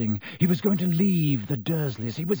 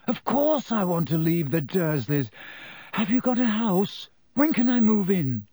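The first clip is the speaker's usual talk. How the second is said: put-on higher-pitched excited boy; lightly voiced Harry, eager; 6 semitones higher than usual. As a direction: jump at the offer, questions rising eagerly.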